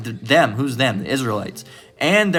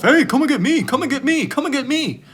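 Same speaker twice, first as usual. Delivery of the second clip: high-pitched, squeaky mock rabbit voice; taunting rabbit; 13 semitones higher than usual. Rabbit calling to the wolf to come get it, high mock taunt. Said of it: "you're a rabbit, and you're running towards the wolf".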